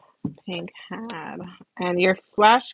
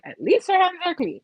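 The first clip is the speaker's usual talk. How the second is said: imitating onlookers; 12 semitones higher, 4 dB louder than usual. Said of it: "people can look at my hands and be like".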